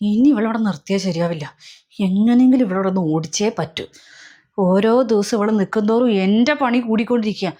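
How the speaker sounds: a schemer muttering her plot; scheming aside. Acted. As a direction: grumble the plan to yourself. Plotting to drive girl away.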